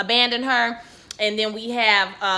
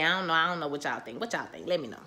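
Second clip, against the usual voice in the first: trailing off; 6 semitones lower, 9 dB quieter, faster than usual. Real, not acted. Trailing question to viewers.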